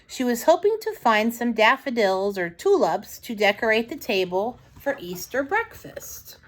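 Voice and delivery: ordinary delivery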